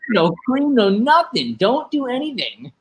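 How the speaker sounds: high, insistent retold rant at the barista; hammering each "no" in mock outrage; exasperated rant. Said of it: "I was like".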